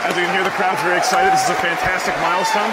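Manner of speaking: loud and high with joy; excited. Real, not acted. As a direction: shout it over the cheering crowd. Crowd cheering, host thrilled.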